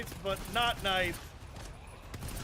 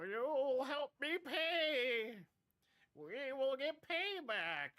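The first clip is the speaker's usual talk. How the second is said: higher, slow put-on villain voice; mock villain vow; 9 semitones higher, 11 dB quieter, much slower than usual. Streamer vowing payback on the creature, hammed-up villain voice. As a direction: drag out a hammed-up vow of revenge.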